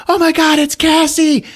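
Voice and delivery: high, loud sudden shout; excited yell. Retold or imitated. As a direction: shout her name in shock and hope. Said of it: "Anya just yells".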